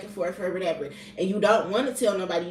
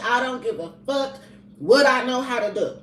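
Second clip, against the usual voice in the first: heated rant; 5 semitones higher, 6 dB louder than usual. Real, not acted. Ranting about panicking over her kids.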